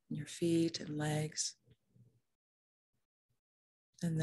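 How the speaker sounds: soft, unhurried meditation voice with long pauses; calm, even meditation leading; guided meditation. Real, not acted. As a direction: guide them gently into the body, leaving room to breathe.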